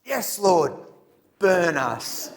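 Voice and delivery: eager amen. Retold or imitated. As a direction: affirm with a grin in the voice. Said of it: "group responding".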